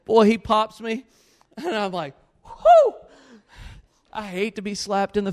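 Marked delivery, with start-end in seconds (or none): 2.4-2.9 loud, very high "whoo" after a slap; high stung whoop. Retold or imitated. Reliving being slapped in the face, high shocked whoop. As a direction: yelp out a piercing whoo.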